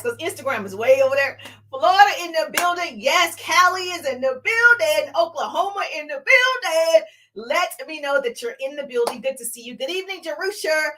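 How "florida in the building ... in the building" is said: raised, singsong hype call; excited roll-call, pitched up; shout-out. Real, not acted. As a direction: hail each arrival like a hype host. Host hails viewers joining live.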